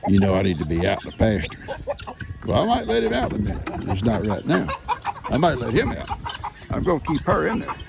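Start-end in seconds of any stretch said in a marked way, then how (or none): 0.1-1.6 a lightly voiced goat, lower and glum; upset goat. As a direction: grumble as a goat stuck in the pen. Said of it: "He said".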